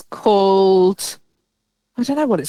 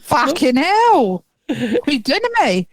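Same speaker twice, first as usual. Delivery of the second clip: exasperated Dorset farmer, drawn-out and higher; local Dorset accent; 6 semitones higher than usual. Acted. Farmer despairing at the builders, in a put-on Dorset accent. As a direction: groan it out in a broad Dorset accent. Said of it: "they probably sound more like they would down here".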